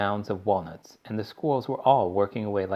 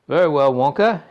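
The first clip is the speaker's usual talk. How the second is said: importantly; 5 semitones higher, 10 dB louder than usual. A narrator's reading. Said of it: "he said importantly".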